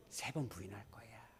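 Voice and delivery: hushed unvoiced whisper. Jesus quietly warning Peter, whispered. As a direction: breathe it like a secret warning.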